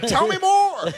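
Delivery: very high laughing eagerness; eager laughing. Retold or imitated. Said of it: "he's like".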